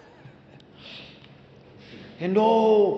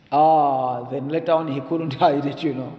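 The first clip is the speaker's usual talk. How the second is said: usual speaking voice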